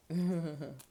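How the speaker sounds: quick burst of laughter; short laugh. Real, not acted. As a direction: laugh at own joke. Laughs after crude joke.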